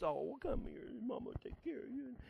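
a hint of a mother soothing, trailing to a whisper; mock mother cooing. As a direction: coo like a mama to her child. Mothers nurturing, voiced.

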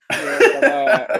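high, loud laughing. Real, not acted. Player cracking up remembering the Argentine accent. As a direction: burst out laughing as you tell it.

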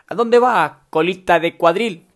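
high, loud, slowing mock yell; mock furious insult. Shown as a demonstration. Host shouting a food name as an insult, mock furious. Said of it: "suena como un insulto".

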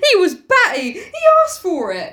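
high, loud shrill blaming. Acted. Piggy blaming the victim, shrill. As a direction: push it high and hard, accusing. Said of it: "His voice shrilled again".

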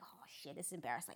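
a hushed, breathy aside to herself; whispered aside. Retold or imitated. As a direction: mutter it under your breath, embarrassed. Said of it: "And I'm sitting there like".

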